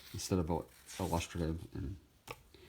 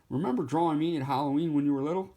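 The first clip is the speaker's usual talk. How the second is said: higher, fast cartoon pumpkin; 6 semitones higher, faster than usual. Halloween pumpkin reminding the artist, high and quick. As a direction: chirp it quickly like a friendly pumpkin. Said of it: "I have him saying".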